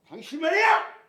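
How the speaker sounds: high, loud indignant outburst from Peter; angry protest. Acted. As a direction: Peter angrily protesting to Jesus. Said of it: "이렇게 막 화내는 거야". (예수님께 화내며 항변하는 베드로)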